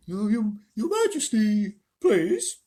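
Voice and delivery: high, slow, hesitant bear; thick, sleepy bear voice. A narrator's reading. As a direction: stammer it out like a drowsy bear, loudly. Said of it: "sleepy voice said".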